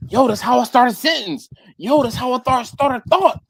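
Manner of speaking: high, quick exasperated defense; insistent explaining. Retold or imitated. Explaining his habit to coworker, high insistent protest.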